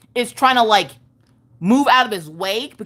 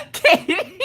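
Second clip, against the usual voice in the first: laughing. Real, not acted. Cracking up at friend's joke.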